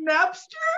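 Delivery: NAPSTER pitched high, rising as a tentative question; upward inflection. Retold or imitated. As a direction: lift the end of "Napster?" right up. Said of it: "with an upward inflection".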